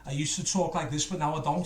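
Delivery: ordinary talk, nothing marked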